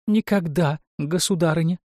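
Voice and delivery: high-pitched chattering magic mirror. A narrator's reading. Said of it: "затрещало зеркало". (высокий голос трещащего зеркала)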